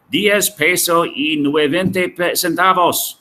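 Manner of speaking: a hint of Mexican Spanish, pricing in pesos; Mexican Spanish accent. Teacher jokes about Mexican currency, in a light Mexican Spanish accent. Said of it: "Or is this Mexico".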